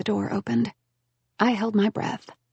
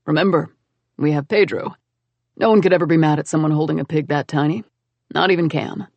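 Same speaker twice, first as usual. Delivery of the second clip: a touch lower, easy teasing reassurance; slightly lower, quick and playful; lightly voiced Matthew; 4 semitones lower, 3 dB louder than usual. To his anxious girlfriend, a hint of teasing calm.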